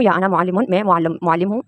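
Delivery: ordinary talk, nothing marked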